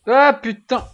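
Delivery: sudden high, loud shout of fright; startled yell. Real, not acted. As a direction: jump scare, yelp in real shock. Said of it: "il m'a fait peur ce con". (cri de frayeur soudain, aigu et fort)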